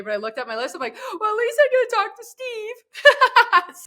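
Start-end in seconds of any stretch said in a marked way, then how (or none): 1.2-3.8 high, loud squeal of delight, drawn out; excited. Retold or imitated. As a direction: gush in a slow, giddy singsong way up high. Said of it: "You're excited".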